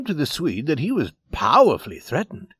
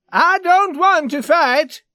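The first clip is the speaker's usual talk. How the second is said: very high, loud, drawn-out cry; panicked shout; 8 dB louder, slower than usual. Acted. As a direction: shout it slower, voice pitched way up. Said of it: "he shouted".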